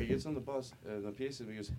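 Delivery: ordinary talk, nothing marked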